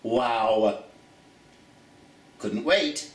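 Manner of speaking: usual speaking voice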